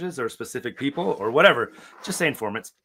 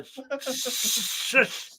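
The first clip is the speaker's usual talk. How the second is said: mock shushing; 10 semitones higher, 4 dB quieter, much slower than usual. Shushing during game.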